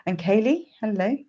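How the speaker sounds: normal talking voice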